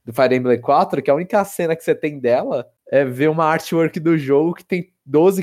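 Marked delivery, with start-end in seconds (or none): none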